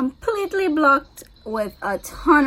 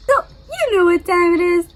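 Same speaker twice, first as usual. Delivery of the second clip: loud, high, hyped announcement; excited; 7 semitones higher, 6 dB louder than usual. Real, not acted. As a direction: pump it up like a show host. Announcing breakfast time.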